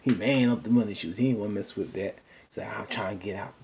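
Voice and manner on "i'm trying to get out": hushed whisper, wanting to escape; whispered plea. Retold or imitated. Kurt slipping away from the fight, hushed whisper. Kurt fleeing the party drama.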